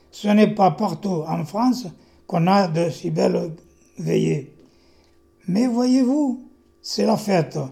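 slow, measured verse delivery with lifted emphasis; poem recitation. Acted. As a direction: recite your poem to the room, loud and deliberate. Recites own poem aloud. (récitation de poème, lente et bien scandée)

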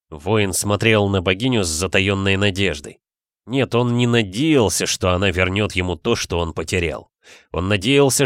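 ordinary delivery, nothing marked

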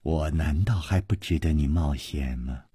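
low, hushed seductive question; softly teasing temptress; soft coaxing. A narrator's reading. Villainess coaxing the hero seductively.

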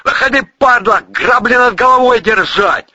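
loud, high-pitched threatening shout; barked order. A narrator's reading. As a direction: bellow it like a hostile sentry. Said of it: "рявкнули сверху".

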